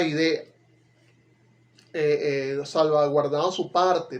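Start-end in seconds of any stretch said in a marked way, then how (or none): none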